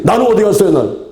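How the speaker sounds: high, loud protest to the Lord; indignant questioning. Acted. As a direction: demand an answer, pitched right up. Acted believer protests to the Lord. (높고 크게 따져 묻기)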